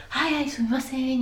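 high, put-on courteous reply; theatrically polite, pitched high; conformist polite answer. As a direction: imitate an over-polite greeting. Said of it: "they answer like".

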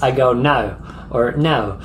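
no with an Aussie vowel glide; no with a hint of an Australian accent; Australian-sounding no. Shown as a demonstration. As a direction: say no like you're from Queensland. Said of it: "I say it a little bit different".

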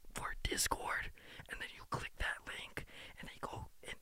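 whispered like a secret tip, no voice; conspiratorial whisper. Real, not acted. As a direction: spill the secret in a hushed whisper. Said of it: "I'm gonna be a whistleblower".

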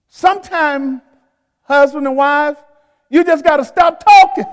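loud, high-pitched preaching; forceful preaching. Real, not acted. Pastor warns husbands and wives about talk, forceful preaching. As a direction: drive it home to the congregation, loudly.

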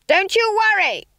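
high, loud scream over a din. A narrator's reading. Witch on burning broomstick insists to friend, screamed. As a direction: scream to be heard over crackling flames. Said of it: "screamed above the crackle of flames".

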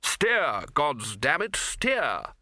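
barked order. A narrator's reading. Arch-Chancellor to the bursar on a broom, urgent bark. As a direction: bark a command at a hapless pilot.

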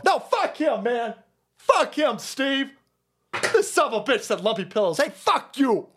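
an over-the-top angry tirade, pitched high; mock furious rant. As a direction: rant at the lawyer, high and indignant. Retelling Lindell's outburst.

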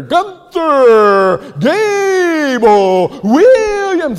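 drawn-out booming call, high and loud; circus announcer. Retold or imitated. Pastor mimicking the ringmaster again, huge drawn-out call. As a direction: bellow the name like a ringmaster. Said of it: "And then ten minutes later".

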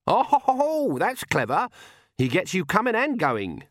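put-on high old-woman voice, relishing the cleverness; crotchety granny, gleefully sarcastic. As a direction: crow sardonically in a high granny voice.

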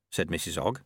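plain reading voice

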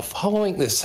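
high-pitched silly read-aloud voice; funny voice. Pretend. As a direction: start reading in a goofy, high voice. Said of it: "Do a funny voice".

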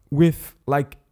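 normal talking voice

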